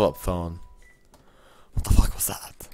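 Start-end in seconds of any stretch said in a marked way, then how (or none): 1.7-2.7 stunned whisper; hushed shocked whisper. Real, not acted. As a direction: breathe it out in disbelief. Stunned after phone noise.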